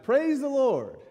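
high, loud shouted praise; loud exultant praise; joyful shout. Retold or imitated. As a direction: burst out with joy. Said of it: "And she shouted".

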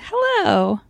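usual speaking voice

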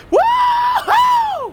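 loud high woohoo; whooping cheer. Acted. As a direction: whoop like you won. Intro skit celebration.

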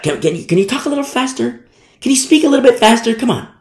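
high, hurried mock complaint; impatient complainer. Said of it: "I've had these complaints before".

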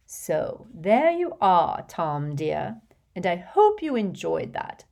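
put-on fussy, gushing rich old lady, laughing in; a vain old witch showing off to a charming boy; giggly hostess voice. Hepzibah wrapping up.

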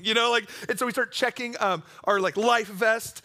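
normal talking voice

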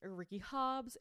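normal talking voice

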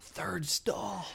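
joking hushed whisper; furtive whisper. Pretend. Man jokes about a restroom signal, furtive whisper.

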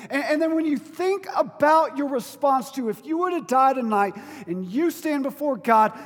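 loud, high, impassioned sermon delivery; fervent pulpit climax; full-force preaching. Acted. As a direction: preach it to the back row.

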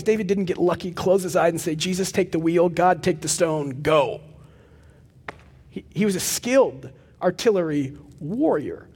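ordinary talk, nothing marked

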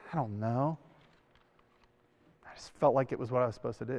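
low and soft, with long pauses; hushed, halting admission; quiet, hesitant answer. Retold or imitated. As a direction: imitate someone unsure of his own reasons. Retells the senior's humble answer.

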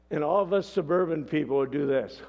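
ordinary delivery

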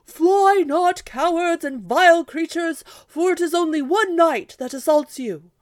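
high, loud knight's challenge hurled at the windmills; loud battle shout. Acted. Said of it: "shouted to the windmills in a loud voice".

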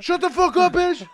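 loud, high mock yell back; retold angry yell. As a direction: yell over the screaming to silence her. Retold yell back at screaming girlfriend.

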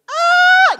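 loud, high-pitched shriek; mock fan scream. Fan facing his idol, hammed-up scream. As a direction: burst into a sudden piercing fan scream.

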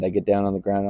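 plain reading voice

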